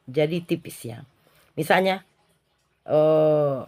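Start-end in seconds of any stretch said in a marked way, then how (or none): none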